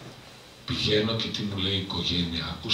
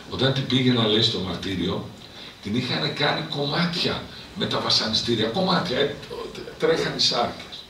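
ordinary talk, nothing marked

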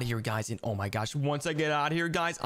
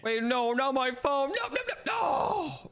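high, slow wailing no's; desperate protest; 8 semitones higher, slower than usual. Acted. Kid begs dad not to take his phone, wailing. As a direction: wail to save your phone.

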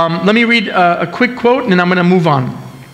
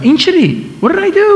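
much higher-pitched, acted dismay; dismayed self-questioning; 11 semitones higher, much faster than usual. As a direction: ask yourself in high, mock-horrified disbelief. Aghast at his own past yelling.

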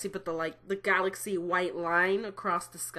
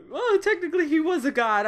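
laughing; 8 semitones higher, 4 dB louder than usual. Real, not acted. Still laughing.

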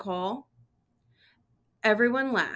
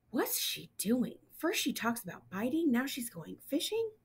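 softer, whispered wondering; puzzled aside; 4 semitones higher, 7 dB quieter than usual. Acted. Simon puzzling over Grandma Rose, soft confused aside. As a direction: muse under your breath, baffled.

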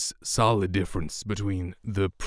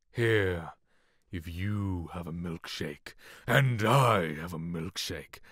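acting a scene; much slower than usual. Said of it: "and then acting".

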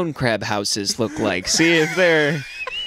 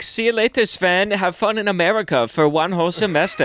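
high-pitched European parent impression; cheery mock-European farewell, pitched up; European send-off; 7 semitones higher than usual. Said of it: "if you get a European kid".

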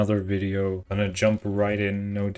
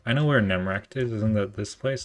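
ordinary talk, nothing marked

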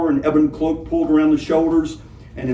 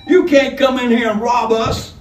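weak courage; 10 semitones higher, 6 dB louder than usual. Acted. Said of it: "showing a weak flame of courage".

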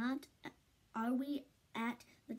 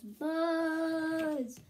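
high, loud, sung-out buzzy call; Fly Guy yell; 4 semitones higher, 4 dB louder than usual. Acted. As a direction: belt the name like a buzzing fly. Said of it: "And Fly Guy yells".

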